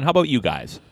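normal talking voice

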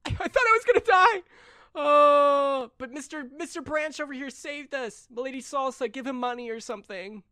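put-on high-pitched kid voice, first loud; frightened kid. Crow telling his counselor he almost died, high kid voice. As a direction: squeak it out like a scared little kid. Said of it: "Crow says".

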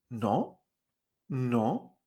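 "No" starting low and sweeping up; doubtful rising tune. Shown as a demonstration. Teacher likens second tone to doubting, rising "no". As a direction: draw "no" upward like a wavering question. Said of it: "Subes hacia arriba".

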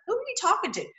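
mock question. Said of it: "It was like".

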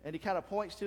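normal talking voice

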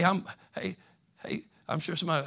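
ordinary talk

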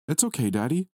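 put-on high, forgiving small boy; lightly voiced child; little boy voice. A narrator's reading.

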